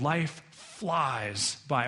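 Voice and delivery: LIFE and FLIES drawn out very slowly; very slow, pondered "Life flies by"; weighty and slow. Acted. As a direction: stretch "flies" right out, with a pause before it. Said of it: "[name] is going".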